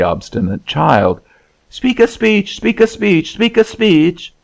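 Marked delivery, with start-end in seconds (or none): 1.7-4.3 put-on high-pitched chorus of nagging children; clamouring children. A narrator's reading. Children badgering their obstinate brother, high insistent clamour. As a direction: chant it insistently in a much higher child's voice. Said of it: "began to clamour violently at".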